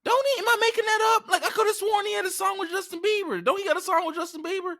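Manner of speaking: way up high, squeaky disbelief; high-pitched disbelief. Real, not acted. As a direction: shoot the voice up in outrage. Incredulous rant about [name].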